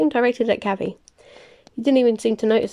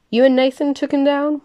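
matter-of-fact; 6 semitones higher, 5 dB louder than usual. A narrator's reading. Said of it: "sounding completely matter-of-fact".

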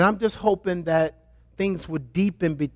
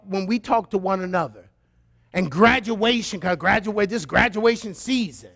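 usual speaking voice

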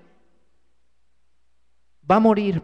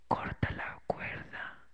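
slow, breathless, voiceless whisper from afar; ghostly whisper; 15 dB quieter, slower than usual. Acted. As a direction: whisper it slowly like a voice out of nowhere. Mysterious voice answers the climber. (susurro lento y misterioso)

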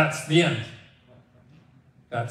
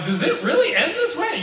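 put-on a stunned kid asking, pitched way up with a laugh; mock stunned kid; 14 semitones higher, 3 dB louder than usual. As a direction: play a kid who can't believe it. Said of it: "they had to go ask their mom".

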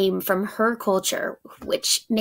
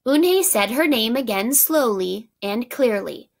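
plain reading voice

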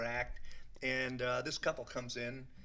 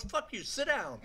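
Whoopi Goldberg; 11 semitones higher, slower than usual. Retold or imitated. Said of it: "she goes".

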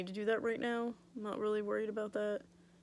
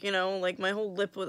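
usual speaking voice

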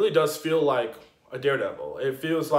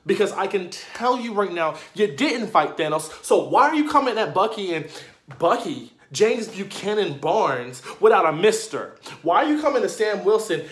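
indignant rant; 4 semitones higher than usual. Acted. Fan scolding newcomers for disrespecting Bucky and Sam, indignant. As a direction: scold them with raised voice and punched words.

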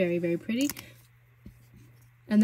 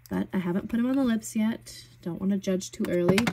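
ordinary talk

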